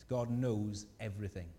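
ordinary delivery

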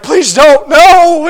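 rising to a high, loud, hammed-up cry of no; comic panic, building to a yelp; mock panicked plea. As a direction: play the introvert begging not to be picked. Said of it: "They're like".

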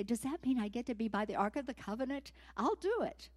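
fast, rising to a high eager cry; can hardly contain the excitement; eager volunteer. Retold or imitated. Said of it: "I can see him say".

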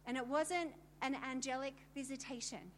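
ordinary delivery

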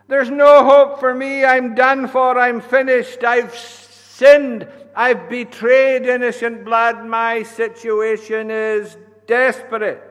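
high, loud, despairing cry; desperate remorse. Acted. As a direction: cry out in hopeless guilt. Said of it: "trembling in remorse".

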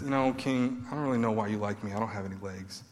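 soft, quick, self-pitying mumble; mopey mumble. Pretend. Mephibosheth years later telling the king he has no legs, mopey. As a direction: shrug it off sheepishly. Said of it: "and says".

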